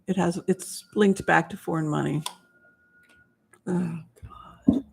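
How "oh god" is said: whispered dread. Real, not acted. Reacting to grim vision.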